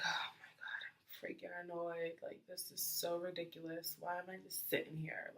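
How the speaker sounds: hushed, irritated muttering to herself; annoyed grumble. Retold or imitated. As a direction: complain under your breath. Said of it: "I'm basically just like".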